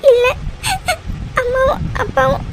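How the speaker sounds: broken words between sobs; too tearful to finish the sentence; crying. Acted. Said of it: "அழாத". (விம்மலுக்கு நடுவே திக்கித் திக்கி)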